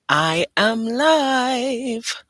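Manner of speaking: very slow, high, loud put-on voice; milk it like a grand announcer; theatrical slow proclamation; dramatic. Real, not acted. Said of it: "final stretched declaration".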